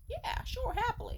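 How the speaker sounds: a high, sarcastic sure. Real, not acted. Sarcastic agreement.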